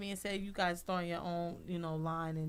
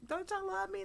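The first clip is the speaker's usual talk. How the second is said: pleading; 13 semitones higher than usual. Retold or imitated. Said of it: "I was like".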